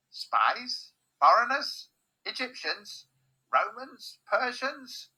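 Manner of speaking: high-pitched suspicious guessing. A narrator's reading. Squinty guard guesses who the intruders are, high-pitched questioning. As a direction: throw out each guess as a startled question.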